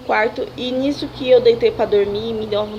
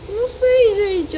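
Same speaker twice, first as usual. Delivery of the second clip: half-cried words; 10 semitones higher, 4 dB louder, slower than usual. Retold or imitated. Continues tearful retelling.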